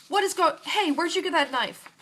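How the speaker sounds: high, loud startled outburst; startled chef. Acted. Chef walking in on the girl with a knife, startled and loud. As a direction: burst in, caught off guard.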